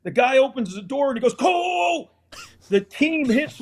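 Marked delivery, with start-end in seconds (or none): 1.3-2.1 official's call. Retold or imitated. Official calling through door.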